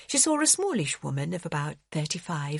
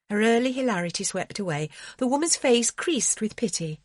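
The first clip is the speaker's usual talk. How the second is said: ordinary delivery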